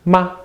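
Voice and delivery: "ma" short, light and high; brief "ma" with no contour; neutral tone. Shown as a demonstration. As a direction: drop in a quick toneless "ma". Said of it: "now we have the neutral tone".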